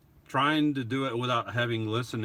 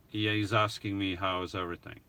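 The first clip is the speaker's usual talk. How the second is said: normal talking voice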